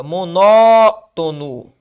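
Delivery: "Monótono" with NÓ held very long and loud; stressed syllable stretched. Shown as a demonstration. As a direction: hammer and stretch the NÓ in "Monótono", then drop to "tono". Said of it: "onde é que tá a sílaba tônica?". ("Monótono" com a sílaba tônica NÓ bem forte e alongada)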